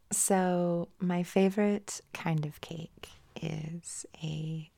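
slow sultry whisper; sexy voice. Pretend. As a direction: seduce them with a slow whisper. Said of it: "but in a sexy voice".

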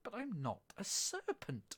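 high, slow, emphatic denial; indignant protest. Acted. Alice denies the pigeon's charge, protesting. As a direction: insist on it, pitched right up.

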